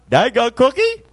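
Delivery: high, loud questioning squawk; mimicking pet bird. Forgotten macaw calls after owner heading out the door, high bird squawk. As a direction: pipe up like a talking bird. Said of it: "I was headed out the door".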